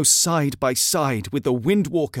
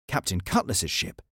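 usual speaking voice

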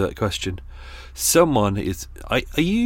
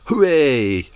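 sarcastic little cheer, a bit louder; mock cheer; 4 semitones higher, 6 dB louder than usual. Mocking New Year revellers, sarcastic cheer. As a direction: fake party enthusiasm.

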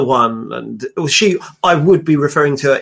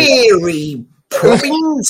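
hammed-up, high Mary Poppins English; mock English accent; 7 semitones higher, much slower than usual. Guest mimics Americans doing an exaggerated English accent. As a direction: stretch it out as caricature English. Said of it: "Do an English accent".

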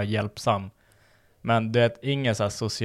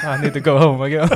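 louder, higher, laughing through the English line; laughing excuse; 4 semitones higher, 9 dB louder than usual. Retold or imitated. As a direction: giggle out a fake excuse to flee. Retelling an excuse to escape. (skrattande ursäkt)

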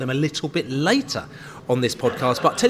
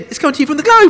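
much higher, louder announcing; big announcement; 10 dB louder, faster than usual. Acted. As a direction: belt it out to the pub. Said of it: "shall I do it again".

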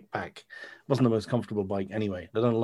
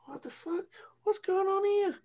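much higher, squeaky disbelief; mock shock. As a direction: act stunned and outraged in a high squeal. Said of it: "There was like".

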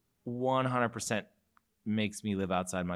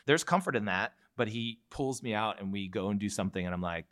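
ordinary talk

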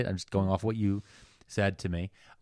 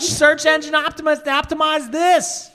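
much higher, much louder mocking shout; speakerphone rant; 7 dB louder than usual. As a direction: gloat like a deranged teacher on speaker. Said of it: "this is my speakerphone voice".

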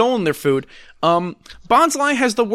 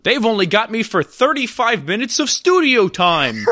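hammed-up voice actor racing the studio clock; rushed narrator; 5 semitones higher than usual. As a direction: play a frantic, bad cartoon narrator. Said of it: "he talks super fast".

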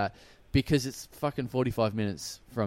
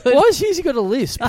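teasing; 12 semitones higher, 6 dB louder than usual. Real, not acted. Teasing about the impression.

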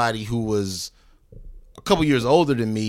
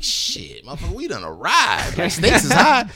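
thrilled reaction; 5 semitones higher than usual. Retold or imitated. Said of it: "I'm like".

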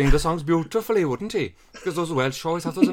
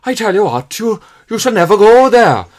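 lightly Welsh lion king, loud and fatherly; Welsh accent as Mufasa; 8 dB louder than usual. Pretend. Friend does Welsh Mufasa for the panel, hammed-up Welsh accent. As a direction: Mufasa warning Simba in a hammed-up Welsh lilt. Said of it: "It sounds like he grew up somewhere else".

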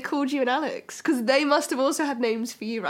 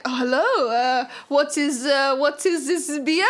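slow English with a mock foreign accent, lightly done; a dad ordering beer abroad in a lightly put-on foreign accent; fake foreign accent; slower than usual. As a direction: play a Brit abroad faking a foreign accent. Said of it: "speaking English with a foreign accent".

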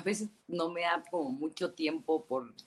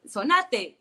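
mock Argentine dad barking an order, higher and louder; dad's Argentine shout; 6 semitones higher, 7 dB louder than usual. Dad orders his daughter to blow her nose, mock Argentine shout. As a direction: shout it like an Argentine father at a match. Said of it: "y me grita mi papá".

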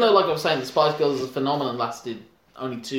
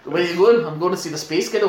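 Scottish accent; 3 semitones higher than usual. Shown as a demonstration. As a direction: rattle it off like a Glaswegian. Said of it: "don't even do the accent".

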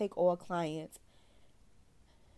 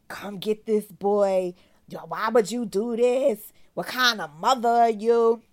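angry messages; 4 semitones higher, 9 dB louder than usual. Retold or imitated. Angry messages from the child's father.

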